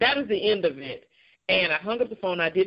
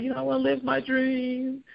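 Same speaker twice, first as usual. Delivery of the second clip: tearful plea; 8 semitones higher than usual. Retold or imitated. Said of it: "I'm crying".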